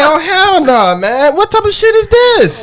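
high-pitched, drawn-out disbelief; incredulous protest. Retold or imitated. Man retelling reaction to his ex's insult, incredulous protest. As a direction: replay your outrage, voice climbing high at the end.

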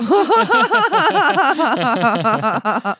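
loud, high burst of laughter; long, helpless high-pitched cackling laugh; big laugh. Real, not acted. Comedian cracking up at own delivery, loud laughter.

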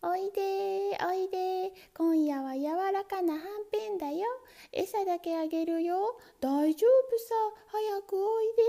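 high, sweet luring voice; coaxing mousetrap. Acted. Mousetrap calling the mouse in, sweetly coaxing. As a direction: lure the mouse in gently.

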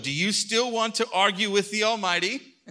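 high, loud divine challenge; booming God. Acted. God challenging Job, high and loud. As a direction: thunder it down at Job.

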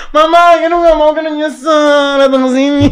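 aghast lament; dismayed wail. Real, not acted. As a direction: whine to mama in disbelief. Reacting in shock to friend.

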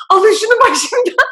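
high, loud, slow tearful cry for help; crying outburst. Retold or imitated. Retelling her breakdown to a friend, high tearful yell. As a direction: break down and plead, voice cracking. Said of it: "dayanamadım ve ağlamaya başladım".

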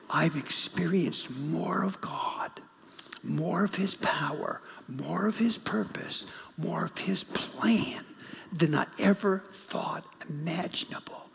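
hushed whisper, slowing at the end. Retold or imitated. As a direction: breathe it out like a confession. Father's testimony, continued.

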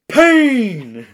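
loud, high menacing boxer growl; bad acting. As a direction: spit out the threat like a villain. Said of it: "I don't think he delivers it like that".

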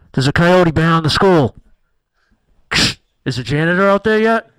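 loud radio summons, acted; walkie-talkie call. Principal radios for the janitor, walkie-talkie voice. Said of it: "is over my walkie-talkie".

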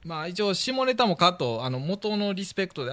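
ordinary talk

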